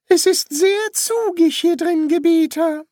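very high-pitched put-on priest voice. Priest addressing his young master, high put-on voice. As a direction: pitch the priest far above the narrator.

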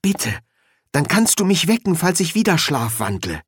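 plain reading voice

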